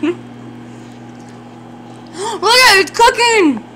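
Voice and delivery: excited. Real, not acted. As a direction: burst out with delight. Food puffing up in microwave.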